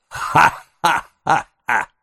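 high, spaced-out "ha-ha-ha-ha"; acted villainous laugh. A narrator's reading. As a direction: laugh in separate, high, deliberate bursts.

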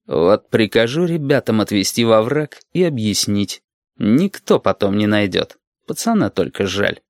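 ordinary delivery

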